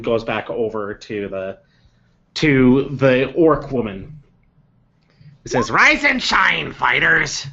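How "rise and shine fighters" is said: orc wake-up call. Acted. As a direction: bellow them awake like a drill sergeant. Orc woman wakes the fighters.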